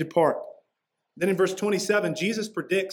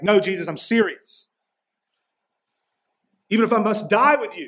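raised, louder, insistent protest; emphatic Peter; 4 semitones higher, 5 dB louder than usual. Retold or imitated. Peter insisting to Jesus he would die with him, emphatic. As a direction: talk over him with mounting intensity. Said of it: "he said emphatically, he just ups the intensity".

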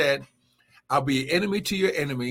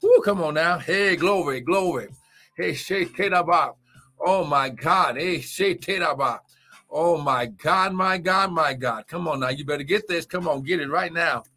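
fiery preaching; 4 semitones higher than usual. Acted. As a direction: shout the praise, build the fire. Preaching at full force.

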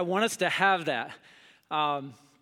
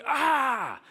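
put-on angry kid yell; 7 semitones higher, 3 dB louder than usual. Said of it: "slam the door".

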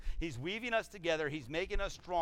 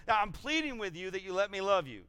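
raised, insistent plea; pleading; 5 semitones higher, 4 dB louder than usual. Acted. God begging people to accept his love, pleading. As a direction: plead earnestly in a higher voice. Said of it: "This is his plead".

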